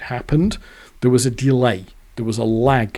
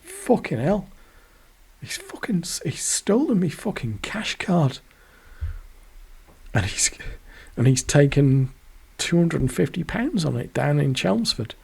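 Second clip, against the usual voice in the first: put-on shocked girlfriend; 5 semitones higher than usual. Girlfriend realising the theft.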